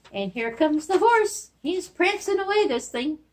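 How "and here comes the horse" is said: usual speaking voice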